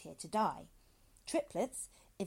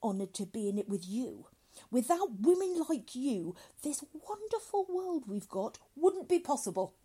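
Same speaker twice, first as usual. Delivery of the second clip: higher, louder, grandly gushing; gushing doctor; 6 semitones higher, 7 dB louder than usual. Acted. Doctor flattering a woman colleague, gushing.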